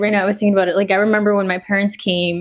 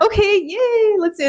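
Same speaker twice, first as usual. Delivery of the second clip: high bright cheer; chirpy fake enthusiasm; playful eager yay; mock excited; 12 semitones higher than usual. Excited past self quoted.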